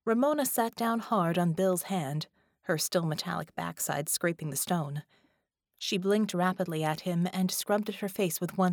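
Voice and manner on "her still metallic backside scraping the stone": ordinary delivery, nothing marked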